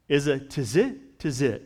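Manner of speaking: "tizit" carefully sounded out as tih-ZIT, twice; careful pronunciation. Shown as a demonstration. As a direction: say "tizit" twice, first rising, then falling. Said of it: "I'm pronouncing the best I can".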